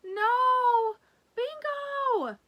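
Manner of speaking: high, loud desperate yell. Acted. Lulu yelling after her runaway dog, loud and high. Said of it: "she yells as loud as she can".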